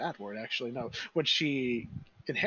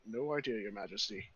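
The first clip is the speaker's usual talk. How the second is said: soft muffled reply, dropping lower at the end; 6 semitones lower, 5 dB quieter than usual. Acted. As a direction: answer softly, as if through a visor. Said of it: "a muffled voice of him talking".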